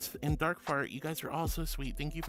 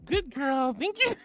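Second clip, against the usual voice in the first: flustered squeal; 10 semitones higher, 6 dB louder, slower than usual. Real, not acted. Viewer called her good girl.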